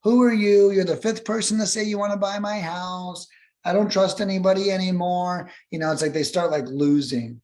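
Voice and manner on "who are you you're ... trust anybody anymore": a bit higher, wary and fed up; suspicious seller. Retold or imitated. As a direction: snap it out distrustfully. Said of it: "Other people are like".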